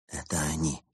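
softly, barely above a murmur; hushed, so as not to be heard; a low hushed warning; quiet. A narrator's reading. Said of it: "тихо сказал Улис".

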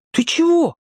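put-on high boyish question; boy's voice. A narrator's reading. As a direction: voice the boy Petka asking. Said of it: "спросил Петька". (высокий мальчишеский голос)

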